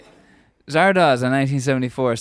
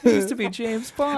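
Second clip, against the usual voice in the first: laughing quote; 9 semitones higher than usual. Retold or imitated. Quoting Connery with a laugh.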